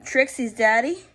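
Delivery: ordinary delivery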